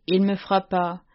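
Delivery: ordinary delivery, nothing marked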